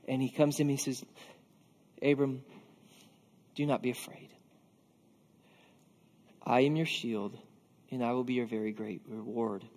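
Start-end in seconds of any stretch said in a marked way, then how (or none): 2.0-9.6 low, soft voice with long pauses; hushed, low comforting words from God; gentle reassurance. Acted. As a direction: reassure quietly and solemnly, pitched well down. God comforting a fearful Abraham.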